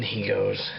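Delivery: ordinary talk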